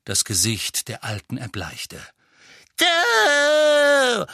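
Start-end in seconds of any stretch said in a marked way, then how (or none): 2.8-4.2 high shrieking howl; howling crone. A narrator's reading. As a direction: wail it like a mad witch. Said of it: "heulte sie".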